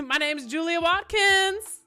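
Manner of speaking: high, slow, projected announcement; loud name announcement. Retold or imitated. Nervous newcomer answers the judge, loud high announcement. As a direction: belt out the name for the court.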